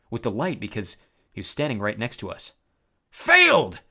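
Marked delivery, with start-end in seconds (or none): 3.1-3.8 high, loud gloating monster shout; gleeful cyclops yell. A narrator's reading. Cyclops yelling gleefully at the heroes. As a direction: crow with delight like a giant. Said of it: "he yelled gleefully".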